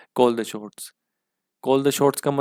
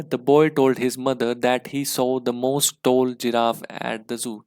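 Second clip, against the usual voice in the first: slow reading aloud; much slower than usual. Shown as a demonstration. Teacher reading question sentence aloud.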